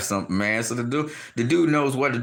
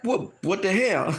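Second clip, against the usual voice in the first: higher, slower incredulous question; stunned disbelief; 7 semitones higher, slower than usual. Retold or imitated. Wilder reacting as the towel flies in, stunned. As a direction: play total disbelief, half laughing.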